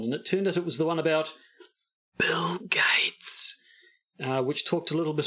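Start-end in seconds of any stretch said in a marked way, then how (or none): 2.2-3.6 whispered name. Pretend. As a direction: whisper it so nobody hears. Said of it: "Just be a little bit subtle there".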